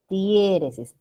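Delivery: "Diéresis" stressed on DIE, die kept as one syllable; correct stress. Shown as a demonstration. Teacher correcting students, "Diéresis" with the stress on DIE. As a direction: hit the first syllable, DIE-re-sis, in "Diéresis". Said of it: "Porque no es diéresis".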